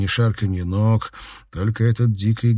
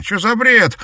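high, loud panicked squeal; shrill squeal; 14 semitones higher, 7 dB louder than usual. Acted. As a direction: squeal it out in disbelief. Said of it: "взвизгнул Пётр". (визгливый выкрик)

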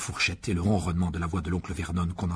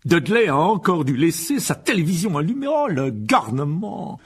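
loud, gruff uncle bellow; loud, grumbling uncle shouting downstairs; 9 semitones higher, 9 dB louder, slower than usual. Acted. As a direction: boom it through the house. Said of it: "d'une voix forte".

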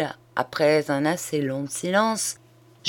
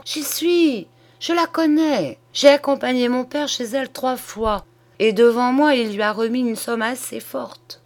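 higher, excited burst of recognition; sudden realisation; 7 semitones higher, 3 dB louder than usual. A narrator's reading. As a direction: exclaim it as it suddenly comes back to you. Sudden recollection, quoted in a story. (exclamation soudaine, voix plus aiguë)

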